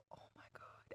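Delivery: breathless whispered horror; a hushed, aghast whisper; horrified whisper. Retold or imitated. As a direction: barely breathe it out in shock. Crying over hair in dream.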